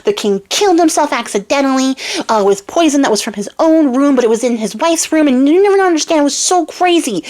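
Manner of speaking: high, fast, breathless babbling; excited dog. Retold or imitated. Dog to master in jail, excitedly babbling. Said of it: "So he's like".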